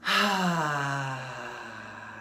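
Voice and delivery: long, voiced "ahh" on the out-breath; cleansing sigh. Shown as a demonstration. Leading listeners in cleansing breaths, long audible sigh. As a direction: exhale audibly, letting it fall away. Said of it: "like I'm doing, feel free to make noise".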